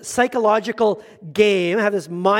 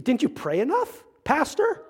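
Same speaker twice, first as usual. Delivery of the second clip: mock accusing, rising high on Pastor; lightly hammed reproach; ticked-off accusation; 6 semitones higher, 4 dB quieter than usual. Mother of the bride scolds the pastor, mock reproach. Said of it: "ticked off with me".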